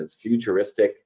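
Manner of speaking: usual speaking voice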